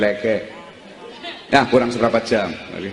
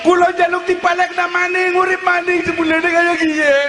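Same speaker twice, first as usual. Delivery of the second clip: high, wailing cry to God; acted wail of the damned; crying plea. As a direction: beg to come back, voice pitched way up. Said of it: "Kowe wong pada nangis".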